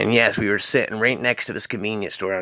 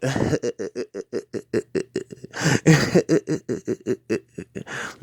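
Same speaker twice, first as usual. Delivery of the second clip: staccato, chuckling cartoon laugh; long stuttering "uh-huh-huh" laugh; crazy Butthead laugh; 3 semitones higher than usual. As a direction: imitate Butthead's dopey machine-gun chuckle. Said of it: "Me and Matt just kept laughing".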